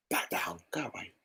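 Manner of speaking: whispered, under the breath; whispered aside. Retold or imitated. Annoyed vlogger thinking at the old lady, whispered. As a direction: hiss it privately so she can't hear.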